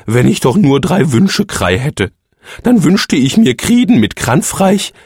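langsam, träumerisch wünschend; träum dich weg; sehnsüchtiger Phönix. A narrator's reading. Phönix wünscht sich sehnsüchtig etwas. (der Phönix träumt von drei Wünschen)

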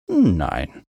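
low, unbothered no; stubborn refusal. A narrator's reading. Mount refuses to rescue the climber, stubborn.

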